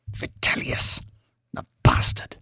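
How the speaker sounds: hissed under the breath; whispered curse. A narrator's reading. Banks cursing Vitellius on sight, whispered. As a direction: spit the name out in a whisper.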